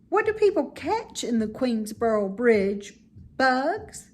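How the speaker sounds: a curious little pig asking; high pig voice. Acted. Wilbur asking Charlotte about the bridge, high and curious.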